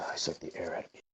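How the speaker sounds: "I suck the air out of people" breathed as a whisper; whispered tagline. Acted. Ex-assassin ghoul reciting his old tagline as a whisper. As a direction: hiss the old assassin catchphrase. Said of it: "my tagline".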